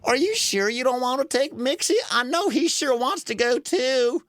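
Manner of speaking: high, considerate truck voice; Dumpy. Acted. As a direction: kindly toy truck speaking up for another.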